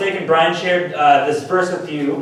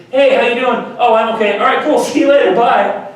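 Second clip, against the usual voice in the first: breezy brush-off; 8 semitones higher, 5 dB louder than usual. Shown as a demonstration. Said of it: "the quick lobby walkthrough".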